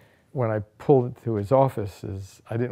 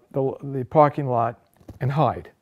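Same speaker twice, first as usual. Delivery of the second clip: ordinary talk, nothing marked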